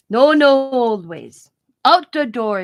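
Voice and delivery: loud, drawn-out mock dismissal; stretched words with firm falls; doctor impression. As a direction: imitate the doctor shooing the idea away. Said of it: "that's the way he talks".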